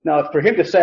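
normal talking voice

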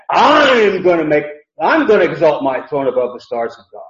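emphatic vow led by a stretched "I'm"; emphatic boast. Retold or imitated. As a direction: lean long and hard on "I'm". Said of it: "it's very emphatic".

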